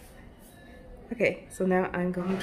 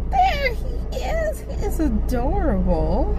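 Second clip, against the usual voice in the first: high, slow cooing voice; 13 semitones higher, slower than usual. Real, not acted. Cooing at a poodle.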